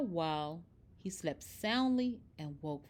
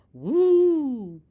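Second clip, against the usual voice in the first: joyful whoop; 5 semitones higher, 11 dB louder than usual. Acted. Bernard plays joyfully with friends.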